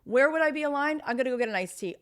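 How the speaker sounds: high, lightly voiced carefree self-talk; mock inner voice. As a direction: play your own breezy inner voice. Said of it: "Maybe it's like".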